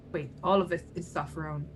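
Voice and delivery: ordinary talk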